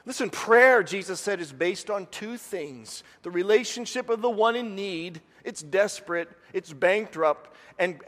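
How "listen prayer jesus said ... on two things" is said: usual speaking voice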